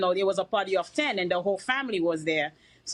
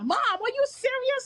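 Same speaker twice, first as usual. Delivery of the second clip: surprised. Retold or imitated. Son's disbelief retold.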